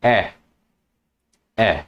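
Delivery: "A" as an open "eh"; grave-accent E sound. Shown as a demonstration. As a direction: say "A" as French è. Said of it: "it makes the sound".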